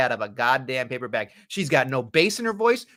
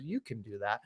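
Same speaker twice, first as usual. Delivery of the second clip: much softer, lower, unhurried line; calm wrestler quote; 7 semitones lower, 14 dB quieter than usual. Retold or imitated. Host imitating Rhea Ripley taking her time with the crowd, much softer and lower. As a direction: hold the crowd with a soft, low, easy line. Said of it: "And she goes".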